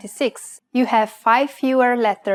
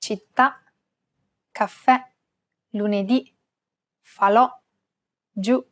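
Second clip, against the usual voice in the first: Città, caffè, lunedì, falò, giù with the accented last vowel stressed; word list, each landing on its final vowel: città, caffè, lunedì, falò, giù. Shown as a demonstration. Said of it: "telling you to stress this vowel".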